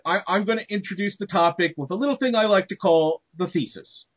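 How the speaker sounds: usual speaking voice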